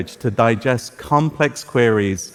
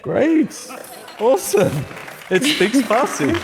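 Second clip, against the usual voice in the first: high, thrilled relief that it worked; genuinely excited and relieved; delighted; 10 semitones higher than usual. Real, not acted. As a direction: let the joy burst out, pitched high. Farsi translation works after failing before.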